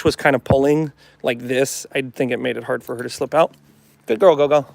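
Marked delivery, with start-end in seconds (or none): none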